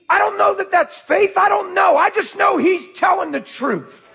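high-pitched, driving preacher's cry; full-force preaching. Real, not acted. Preacher challenging the church's motive, full-force preaching.

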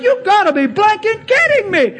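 high-pitched mock incredulity; hammed-up disbelief; imitated astonished swearing. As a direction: play a stunned man cursing in amazement. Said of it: "He's dropping bombs".

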